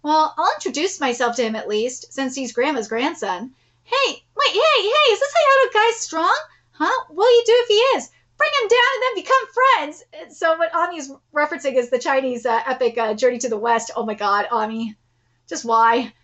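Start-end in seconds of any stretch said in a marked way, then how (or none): 3.8-10.0 high, excited character voice; eager challenger. Acted. Asking if the Hayato guy is strong, high and excited. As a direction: pipe up high, pushing for a fight.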